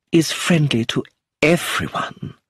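slow, composed dignity. A narrator's reading. Actress defending Brenda to inspector, dignified. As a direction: say it with poised restraint. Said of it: "she said with dignity".

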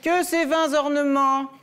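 slow, high, declaiming tragic verse; hammed-up classical tragedy line; tragic Phèdre. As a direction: overblown theatre declamation. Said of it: "C'est Phèdre de Racine".

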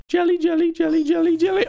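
calling sing-song. Real, not acted. Calling the jellyfish in the game, high sing-song coaxing. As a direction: call them like pets.

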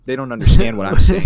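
mock outburst. Mocking the British to his friend, loud fast jab. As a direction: ham up an exasperated jab.

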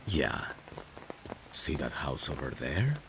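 ordinary delivery

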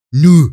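Nu said short and loud; clipped Saxon Nu for yes. Shown as a demonstration. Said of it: "zwei verschiedene Arten, Ja zu sagen".

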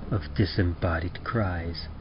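plain reading voice

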